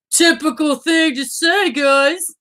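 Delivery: high, strained put-on voice; joking call-out. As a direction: crow it high to the audience. Joking on his own protest.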